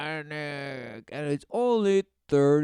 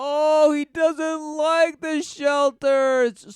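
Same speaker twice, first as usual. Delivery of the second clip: high, loud, slow scoff; exaggerated fake outrage; mock shock; 10 semitones higher, 6 dB louder than usual. Real, not acted. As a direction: stretch it out in sarcastic disbelief. Scoffing at interviewee.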